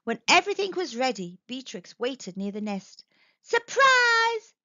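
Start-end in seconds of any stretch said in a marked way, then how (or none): 3.4-4.5 high, loud, drawn-out surprise call; shout. A narrator's reading. Bee shouting as all the bees buzz past, loud. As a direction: shout it out to a passing crowd. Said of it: "she shouted as all the bees".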